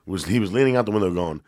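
ordinary delivery

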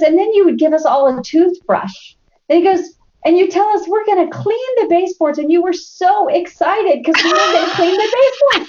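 mock her eager chore plan; son teasing mom. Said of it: "And he says".

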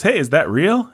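high, lightly voiced cowboy exclaiming; John Wayne impression. Podcaster voicing John Wayne seeing the beauty. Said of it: "John Wayne says".

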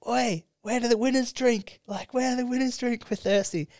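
high-pitched cheeky heckle, all through; high, taunting call to the losing crowd; gloating heckle. Retold or imitated. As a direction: rub it in like a winning fan. Gloating at rival fans after game.

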